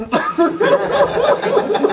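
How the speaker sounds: high, airy burst of laughter. Real, not acted. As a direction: laugh out at the joke. Laughing at own joke. (צחוק גבוה)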